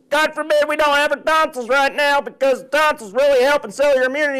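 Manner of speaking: loud, high, excited rally cry to the troops; the body as hyped coach. Pretend. The body rallying its defenses, loud and excited. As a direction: fire up the immune system like a drill sergeant. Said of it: "The body's like".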